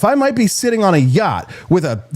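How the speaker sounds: ordinary talk, nothing marked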